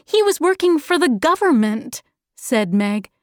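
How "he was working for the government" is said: put-on high, fast child's retort; protesting girl. Meg defending her absent father, high quick protest.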